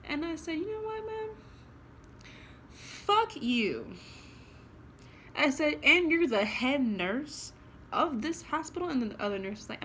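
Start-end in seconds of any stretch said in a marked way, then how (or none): 0.6-3.9 high, sharp mock outburst at the nurse; retold angry outburst. Telling off the head nurse, angry retold outburst. 5.3-8.9 louder, higher indignant challenge; indignant retort. Retold or imitated. Demanding answers from the head nurse, indignant and loud.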